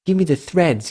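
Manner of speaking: put-on high voice of a hungry fan; imitating eager fans. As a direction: lightly mimic fans begging for news. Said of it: "You got the people going like".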